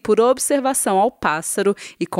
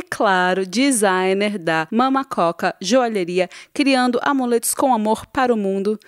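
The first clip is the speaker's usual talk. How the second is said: ordinary talk, nothing marked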